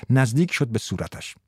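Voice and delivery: ordinary delivery, nothing marked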